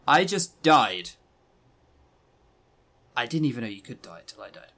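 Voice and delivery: usual speaking voice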